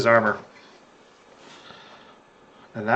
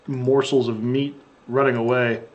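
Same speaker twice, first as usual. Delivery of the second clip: ordinary talk, nothing marked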